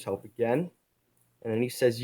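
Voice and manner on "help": ordinary delivery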